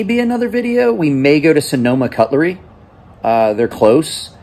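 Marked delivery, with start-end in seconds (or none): none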